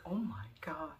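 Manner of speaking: whispered oh my God in shock; stunned whisper. Real, not acted. Seeing the zebra skin, a shocked whisper. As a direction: breathe it out amazed. Stunned by real animal skins.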